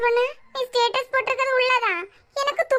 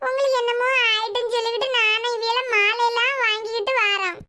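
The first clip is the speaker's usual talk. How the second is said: normal talking voice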